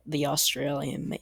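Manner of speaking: usual speaking voice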